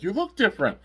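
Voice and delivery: high-pitched, wondering exclamation; exaggerated surprised squeak; mock amazement. Dan seeing Drago's new form, high amazed voice.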